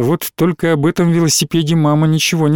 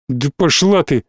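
plain reading voice

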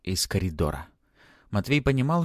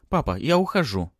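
firm, raised announcement; resolute; 6 semitones higher, 4 dB louder than usual. A narrator's reading. Daughter tells her father she is leaving, resolutely. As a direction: state it decisively to a parent. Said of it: "произнесла она решительно".

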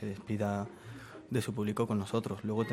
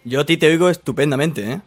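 raised voice over a bad line; loud; 4 semitones higher, 14 dB louder, faster than usual. Real, not acted. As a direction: speak up to the caller. Talking loudly over bad line.

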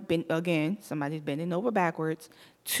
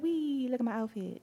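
a light, silly squeal of mock glee; a quiet, mocking show-off squeal; mock twirl voice; 4 semitones higher, 9 dB quieter than usual. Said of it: "be like".

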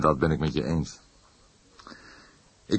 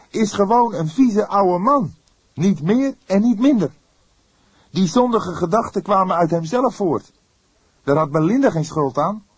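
put-on higher, louder indignant young voice; indignant outcry; 7 dB louder than usual. Young detective outraged at the old priest, calling out indignantly. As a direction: burst out in outrage. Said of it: "riep hij verontwaardigd".

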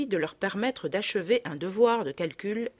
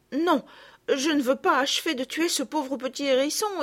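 higher, louder quarrel; lightly voiced bickering children; arguing girls; 4 semitones higher, 6 dB louder than usual. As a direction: push and refuse by the pond. Girls argue by the pond.

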